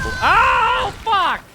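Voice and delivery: loud high cry of frustration; real shouted curse; furious yell. Real, not acted. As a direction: scream at the death. Dies in the game.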